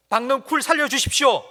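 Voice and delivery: high, loud begging cry as Jonah; loud demand. Acted. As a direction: shout the plea like a spoiled child. Jonah demands God save the vine.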